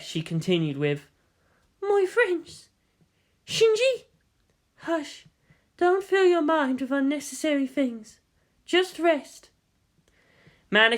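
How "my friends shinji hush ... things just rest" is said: high, gentle character voice. Acted. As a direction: hush them tenderly. Character voice continues.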